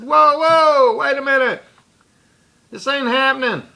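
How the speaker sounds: put-on older sibling breaking up a fight, commanding shout; stepping in loudly. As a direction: shout them down to halt the fight.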